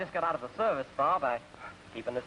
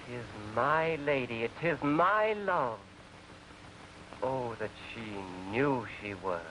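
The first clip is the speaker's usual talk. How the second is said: slow, grand reading of verse; Shakespeare recitation; 2 semitones lower, 3 dB quieter than usual. Pretend. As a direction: declaim the balcony scene with pauses. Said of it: "It goes".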